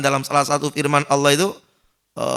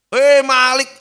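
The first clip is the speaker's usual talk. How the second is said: higher, louder desperate pleading; begging sinner; 8 semitones higher, 7 dB louder, slower than usual. Acted. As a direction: beg to be let out or killed. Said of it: "bukannya senyum malah".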